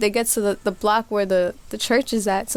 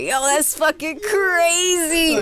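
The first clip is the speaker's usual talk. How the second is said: slow, higher, dazed awe; awed tripping; 6 semitones higher, slower than usual. Retold or imitated. High on the trip, watching the street fight in slow awe. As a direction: let it drift out, amazed and far gone.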